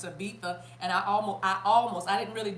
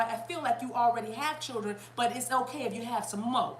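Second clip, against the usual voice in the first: usual speaking voice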